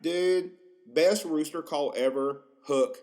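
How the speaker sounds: normal talking voice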